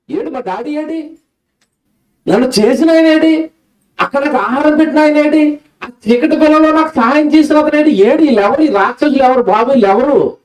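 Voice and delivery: put-on high, plaintive baby voice calling for daddy; a lost infant wailing where is my father; crying newborn. Newborn crying for God, his father. (ఏడుస్తున్న పసిబిడ్డ గొంతు, ఎత్తైన స్వరంతో)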